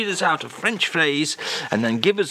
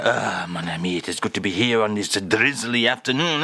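lower, hammed-up French accent; mock French accent; 4 semitones lower than usual. Radio host playing Charles greets the presenter, in a hammed-up French accent. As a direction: play a comic Frenchman greeting his friend.

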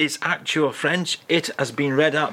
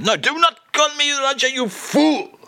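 high, hammed-up indignant French voice; huffy French snap at being misnamed; mock outrage; 13 semitones higher, 3 dB louder than usual. Character scolds host in character.

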